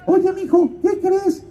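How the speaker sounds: very high godmother caricature. Retold or imitated. Godmother phoning her godson with news, in a very high put-on voice. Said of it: "me habla y me dice".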